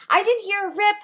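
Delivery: stunned, baffled repetition; rising disbelief. Real, not acted. That's my sort of freak out.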